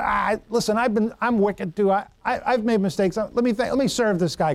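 ordinary delivery

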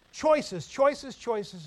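loud and high, then fading down; mocking emphasis. Real, not acted. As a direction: hammer the word, then let it fall away. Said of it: "repeated for emphasis, fading".

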